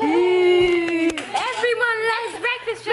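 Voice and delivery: slow, loud cheer; excited shout. Real, not acted. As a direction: shout it out with joy. Cheering for breakfast.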